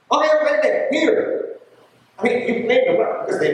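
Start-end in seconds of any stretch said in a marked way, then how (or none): 0.1-1.6 irritated giving in. Retold or imitated. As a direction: sigh it out like a nagged person giving up. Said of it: "They get so irritated".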